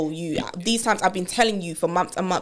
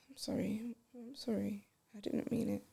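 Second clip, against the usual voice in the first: unvoiced whisper, ashamed; whispered apology; 2 semitones higher, 22 dB quieter, slower than usual. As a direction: whisper sorry as if afraid.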